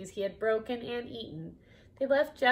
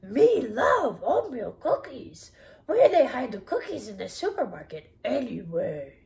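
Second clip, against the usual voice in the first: crave cookies like a hungry monster; 7 dB louder than usual. Acted. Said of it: "It's my best Cookie Monster".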